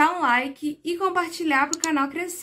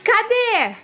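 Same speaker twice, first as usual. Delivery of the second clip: excited; 8 semitones higher, 4 dB louder than usual. Real, not acted. Dogs arrive home, greeting them.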